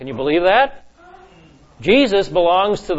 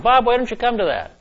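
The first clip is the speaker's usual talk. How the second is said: a lightly voiced teacher, higher and inviting; teacher's invitation; 7 semitones higher than usual. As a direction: invite him along, friendly. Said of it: "Andrea was the name of the teacher".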